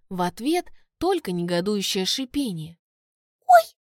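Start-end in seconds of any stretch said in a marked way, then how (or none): 3.5-3.8 high, loud startled squeak. A narrator's reading. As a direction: squeak in surprise. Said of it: "Фея пискнула".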